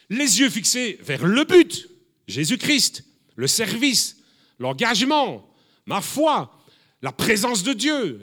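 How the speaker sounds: preacher declaring the goal; full-force preaching. Acted. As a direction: hammer each item out to the congregation.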